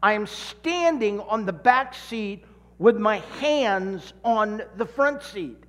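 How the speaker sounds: ordinary delivery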